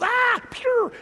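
high, loud startled yelp and whoosh; panicked shout. Retold or imitated. Preacher voicing terrified gazelles bolting, high shout. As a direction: yelp and dart away like a spooked gazelle.

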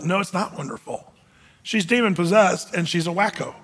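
normal talking voice

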